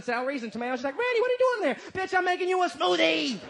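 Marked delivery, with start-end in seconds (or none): 1.0-1.8 put-on high-pitched, raised-voice girlfriend calling; high voiced girlfriend demanding an answer; woman calling out. 2.1-3.4 high, raised comic retort; mock indignant holler; yelled back. Shouted answer in the bit.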